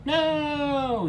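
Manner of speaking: high, loud screaming no; a wounded robot screams out. A narrator's reading. Injured Geth screams in pain, high and loud. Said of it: "screamed the Geth as he lay injured".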